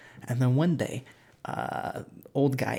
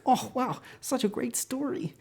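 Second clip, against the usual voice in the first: mock gushing; 11 semitones higher, 3 dB quieter than usual. Listeners react to tale.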